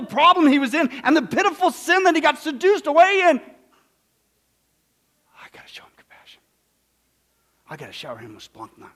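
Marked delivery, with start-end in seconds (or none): 5.4-8.9 hushed whispered resolve; softly whispered father's thought. Acted. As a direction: breathe it as a private decision.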